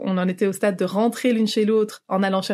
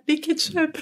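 squeaky inner voice; 7 semitones higher, slower than usual. Retold or imitated. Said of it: "Dans ta tête, t'es là".

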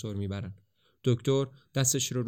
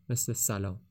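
ordinary delivery, nothing marked

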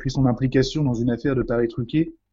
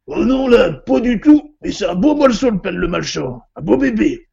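high, loud country voice; rustic butcher; 8 semitones higher, 6 dB louder than usual. Acted. Butcher cheerfully reporting on the corpse, high and loud. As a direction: play the jolly village butcher.

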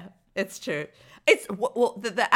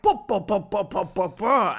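nonsense syllables mimicking French; French accent reduced to bah-bahs; mock French accent. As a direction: babble a rough French accent. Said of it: "the French accent at the beginning was like".

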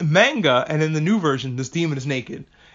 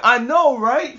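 high, loud mock holler; comic long-distance agreement, pitched way up; calling from afar; 9 semitones higher, 5 dB louder, slower than usual. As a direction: call it out high, as if from far away. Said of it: "From, like, a distance".

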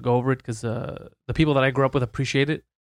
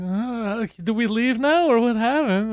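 higher, slow, timid mock voice; lightly hammed-up nervous bystanders; nervous strangers; 7 semitones higher, slower than usual. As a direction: imitate scared students unsure whether to bolt. Students freaked out overhearing crimes.

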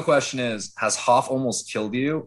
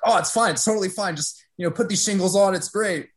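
higher, carefree mock Hoff; breezy imitation of Hoff; 7 semitones higher, faster than usual. Mimicking Hoff telling him to shingle the roof, breezy and higher. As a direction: brush off the danger lightly, pitched up.

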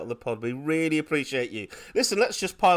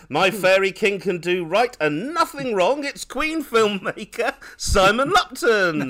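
high, stretched-out guest introduction; podcast announcer; 7 semitones higher, 5 dB louder than usual. Acted. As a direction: build up to the guest's name. Hosts the show intro.

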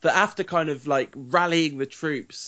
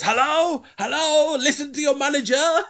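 hammed-up, badly accented call for the crowd's attention; mock manager hailing a chattering room, pitched way up; Louis van Gaal; 13 semitones higher than usual. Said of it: "I can't do the accent".

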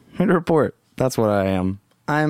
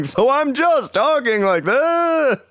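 1 talking like this; 13 semitones higher than usual. Shown as a demonstration. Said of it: "take one of them out of the recording".

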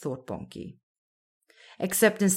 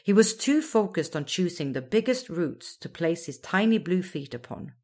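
plain reading voice

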